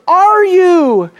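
high, loud, stretched-out cry; emphatic shout. Acted. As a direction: declaim it like an accusing high priest. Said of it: "now standing and shouting".

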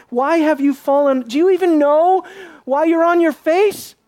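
rising, high-pitched demand; indignant challenge. Acted. God challenging Joshua lying face down, indignant. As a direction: build in pitch to an exasperated question.

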